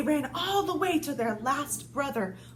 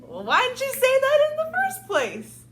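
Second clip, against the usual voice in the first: exasperated piggy; 10 semitones higher, 6 dB louder than usual. Acted. Pig exclaims kindly to wolf.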